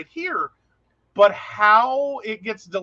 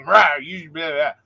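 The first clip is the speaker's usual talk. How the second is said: mock guttural growl, no inflection; guttural deadpan; 4 semitones lower, 6 dB louder than usual. Imitating a brother who jokes with no emotion, guttural. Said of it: "It is the most guttural".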